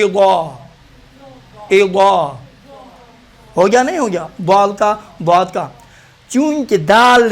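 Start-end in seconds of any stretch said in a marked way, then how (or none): 0.0-2.4 اذا as heavy "idaw", tongue against the palate; thick, rounded d-like ض in اذا; Arabic daad. Shown as a demonstration. Teacher lifts tongue to palate, heavy daad. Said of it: "تالو سے لگا کے آپ تلفظ کریں".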